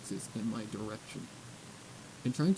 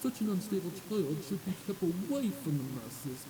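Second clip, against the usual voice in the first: higher, haughty murmuring noble; posh gossip; 5 semitones higher, 13 dB louder than usual. Acted. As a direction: condemn her with upper-class disdain. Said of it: "Their voices weren't as quiet".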